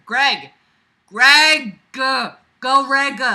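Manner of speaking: loud shouted call of a name. Real, not acted. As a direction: yell across the room. Calling out a name.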